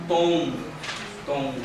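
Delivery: "tom" said gently, with a nasal ending; milder sound. Shown as a demonstration. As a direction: soften "tom" into a mild sound. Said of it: "é mais brando".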